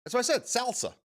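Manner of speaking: high, protesting salsa with a as in "pal"; insistent mispronunciation. Retold or imitated. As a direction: insist on salsa with a flat short a. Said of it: "they tried to tell him".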